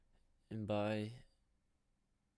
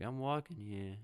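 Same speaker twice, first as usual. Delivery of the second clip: louder mock New Yorker protest; New Yorker impression; 3 semitones higher, 5 dB louder than usual. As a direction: call it out in a put-on New Yorker accent. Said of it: "pretend to talk like a New Yorker".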